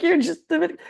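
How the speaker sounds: high-pitched, loud mocking quote; Mitchell imitation. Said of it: "Mitchell's like".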